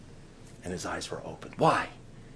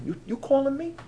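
high-pitched Brooklyn comeback; Brooklyn accent. Acted. Preacher joking about being called, high Brooklyn accent. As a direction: answer like an incredulous Brooklynite. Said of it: "Like a good Brooklyn".

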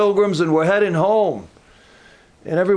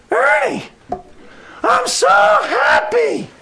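put-on very high, loud, slow joyful old-man voice; high, slowed, shouted old-man joy; overjoyed old man; 4 dB louder, slower than usual. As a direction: squeal it out like a delighted old man. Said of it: "That's the way he talks".

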